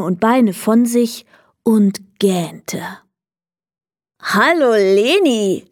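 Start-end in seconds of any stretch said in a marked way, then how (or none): 4.2-5.7 slow, lightly voiced little kobold; a drawn-out kobold hello; greeting kobold. A narrator's reading. Tiny man greeting Leni, lightly voiced and drawn out. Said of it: "grüßte das Männchen".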